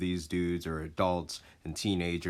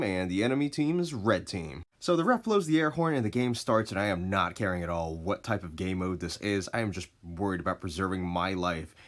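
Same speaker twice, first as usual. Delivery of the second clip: usual speaking voice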